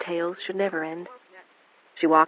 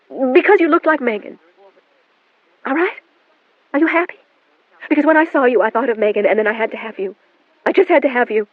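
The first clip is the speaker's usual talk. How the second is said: higher, louder cornered blurt; outburst confession; 7 semitones higher, 6 dB louder than usual. A narrator's reading. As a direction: snap back and blurt the secret.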